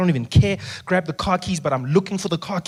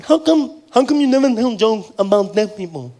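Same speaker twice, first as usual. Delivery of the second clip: higher voice imitating a deaf man's accent; partially deaf speech; 4 semitones higher, 3 dB louder than usual. Fan admits he's partially deaf, higher deaf-accented voice. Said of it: "thought you had an accent".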